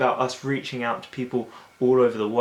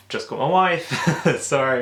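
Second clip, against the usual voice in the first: laughing through it; 4 semitones higher, 6 dB louder than usual. Retold or imitated. Retelling guest's silly excuse.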